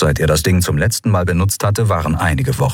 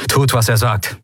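commanding order; 4 semitones higher than usual. A narrator's reading. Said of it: "befahl er".

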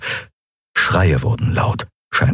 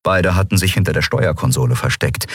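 plain reading voice

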